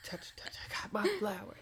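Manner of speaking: fast breathy whispered taunt; mock whisper. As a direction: whisper it like a giddy fool clutching a flower. Mocking the flower-holding idiot.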